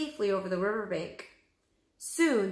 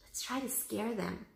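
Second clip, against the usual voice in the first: hushed; 3 semitones higher, 7 dB quieter than usual. A narrator's reading. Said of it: "whispered Annie".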